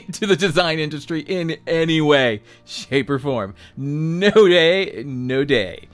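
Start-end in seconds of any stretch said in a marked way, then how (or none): none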